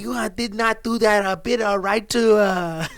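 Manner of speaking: badly faked Japanese accent; Japanese guy impression. Comic mocking the failed bit, fake Japanese accent. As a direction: put on a halting, mock Japanese accent.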